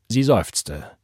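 ordinary delivery, nothing marked

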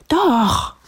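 very high stubborn cry; insistent princess. A narrator's reading. Princess ending the argument, high and insistent. Princess argues with refusing animals.

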